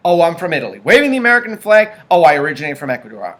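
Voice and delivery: ordinary talk, nothing marked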